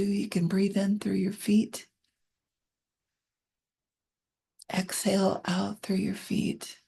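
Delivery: gentle guided relaxation read; guided meditation. Acted. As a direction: ease them down into calm.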